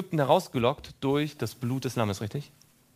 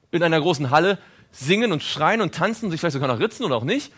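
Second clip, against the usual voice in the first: normal talking voice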